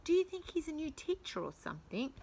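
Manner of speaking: quick, soft hushed question; hushed parent. Acted. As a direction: whisper it to the parent beside you. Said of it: "whispered one of the parents".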